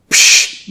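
sharp loud unvoiced psh; breathy dismissive scoff. A narrator's reading. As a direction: wave it off with a hissed psh. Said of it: "It should have been a P F F T".

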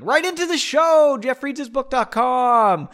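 high, loud announcer voice; announcer plug. Acted. Host plugging the show email, booming announcer plug. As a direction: sell it like a radio jingle.

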